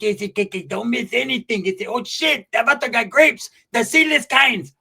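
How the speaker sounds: high, excited voice of a greedy friend; high excited buddy, slowing on the last line; high-pitched Pepe voice. Retold or imitated. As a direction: play the friend gushing over grapes. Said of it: "Pepe's like".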